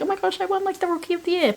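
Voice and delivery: high, thrilled squeal; excited. Retold or imitated. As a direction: gush with joy at winning. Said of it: "really happy".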